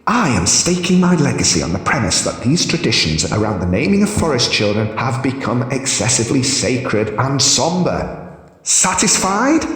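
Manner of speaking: high, mocking Hierophant. A narrator's reading. Hierophant mocks her, high voice.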